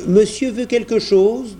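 a hint of an obliging grocer asking; grocer's question. A narrator's reading. Grocer greets a customer. (épicier poli, voix un peu plus aiguë)

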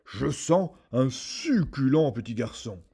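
slow, loud booming giant voice; howling ogre. A narrator's reading. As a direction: roar it, savoring the smell. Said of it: "il hurla".

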